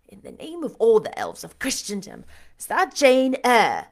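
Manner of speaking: loud, startled demand. A narrator's reading. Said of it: "he demanded".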